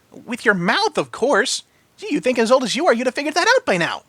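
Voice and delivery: high, cheeky pony voice; teasing Pinky. A narrator's reading. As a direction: tease him like a know-it-all pony. Pinky teases the biker.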